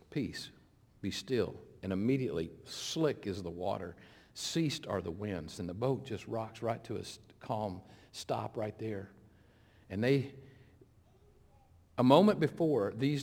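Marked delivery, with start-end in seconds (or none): 0.1-9.1 low, soft and slowing down; hushed calm. Acted. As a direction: bring everything down to stillness, quietly. Storm is calmed by Jesus.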